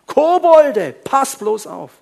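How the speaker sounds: high, loud cry of mock alarm; mock warning. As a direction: shout a hammed-up warning. Mocking alarm about goblins.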